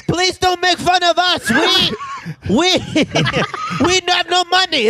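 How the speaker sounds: high-pitched mock pleading; pleading broke country. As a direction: plead up high as a poor little nation, hammed-up. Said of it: "It's always broke motherfuckers that's like".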